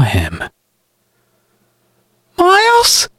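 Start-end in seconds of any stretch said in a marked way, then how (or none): 2.4-3.1 high-pitched questioning call. A narrator's reading. Sister calling up to her brother from the well, calling out. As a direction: call up in startled recognition. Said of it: "she called out, recognizing him".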